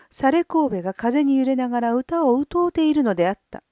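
ordinary delivery, nothing marked

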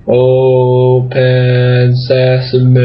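very slow. Shown as a demonstration. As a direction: open sesame very slow, sesame stretched out. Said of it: "Very slow sesame".

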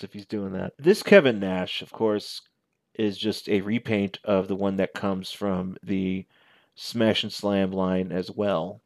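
normal talking voice

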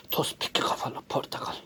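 "Tospik kafalı portakal" whispered low and soft. Pretend. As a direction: breathe it as a secret whisper. Same words whispered.

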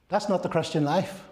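ordinary delivery

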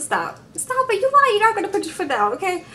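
high-pitched exasperated stop it; incredulous high scold. Real, not acted. Host telling David on TV to stop lying. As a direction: call out his empty threat in disbelief.

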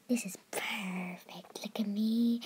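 soft slow whisper. Real, not acted. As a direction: breathe it out softly. Narrator whisper aside.

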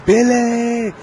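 high, loud shouted action hero yell; Predator movie yell. Retold or imitated. Gamer quoting an action movie while chased, loud high yell. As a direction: bellow it like a movie commando. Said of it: "movie quotes".

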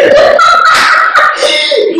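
high unvoiced laughing fit; real laugh. Real, not acted. Laughs at her own exit.

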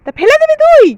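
high, loud disbelieving question; indignant scolding. Acted. Scolds child for throwing it away.

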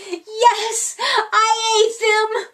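high, loud happy blurt; shouting. Acted. Bella cheerfully shouting her confession to Rocket. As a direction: bellow out the confession gleefully. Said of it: "shouts Bella".

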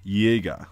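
Jäger as YAY-gah, no R at the end; German final R. Shown as a demonstration. Teacher explaining the last R in German, Jäger with R dropped. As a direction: say Jäger with a Y and open final vowel. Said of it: "they don't pronounce the R".

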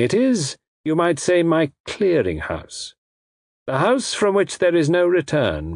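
high, deliberate voice of a courteous villain; polite villain. A narrator's reading. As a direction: menace the captives with elaborate politeness.

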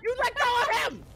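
high, loud frantic yelling. Real, not acted. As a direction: shout at the monster to stop. Yelling at the ghost.

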